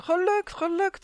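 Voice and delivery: high, loud jubilant shouts; wild cheering. A narrator's reading. Elves celebrate their escape, cheering like mad. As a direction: cheer it out, pitch leaping way up. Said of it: "juichten als gekken".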